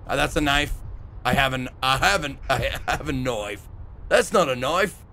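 put-on a half-hearted, weak try at an Australian accent; failed Australian accent. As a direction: botch the Crocodile Dundee line in an Australian accent. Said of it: "I can't do Australian".

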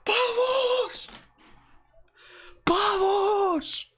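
crying out in triumph; triumphant shout. Real, not acted. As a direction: cheer like a goal was scored. Celebrates the pull.

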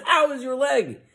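high, grand preacher question. Acted. Preacher turns to Paul, high and expectant. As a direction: pose it like a showman healer. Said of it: "the preacher lifts his hands".

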